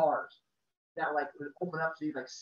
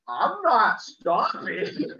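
higher, louder and slow, through a laugh; laughing yell; 8 semitones higher, 6 dB louder, much slower than usual. Retold or imitated. Brother to narrator while fleeing, laughing shout. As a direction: shout back while running off.

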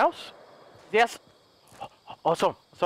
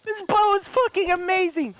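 high-pitched, exhilarated shouting with laughter; excited yelling; 12 semitones higher than usual. Real, not acted. Gamer amazed by his bow kill, excited yelling. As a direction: yell in triumph, pitch way up.

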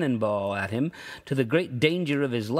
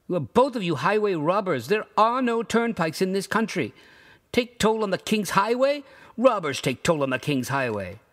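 raised, outraged accusation; indignant accusation; 6 semitones higher than usual. A narrator's reading. Rugg accusing both men of robbery, outraged. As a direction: call them robbers.

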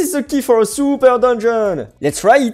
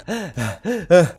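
soft, low winded puffs; out-of-breath panting; 5 semitones lower, 4 dB quieter than usual. Pretend. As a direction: pant as if catching your breath. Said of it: "I must catch my breath again".

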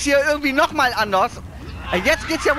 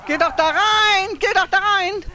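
frustrated yelling; 9 semitones higher, 3 dB louder than usual. Real, not acted. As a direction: yell at them to get inside. Yelling at character to go in.

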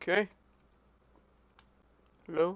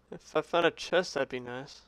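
ordinary delivery

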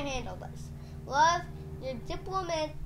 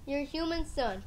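ordinary delivery

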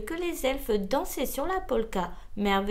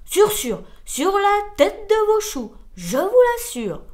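loud, emphatic assurance; emphatic assertion; 6 semitones higher, 8 dB louder than usual. A narrator's reading. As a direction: swear it forcefully, hammering each phrase. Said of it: "affirma l'escargot".

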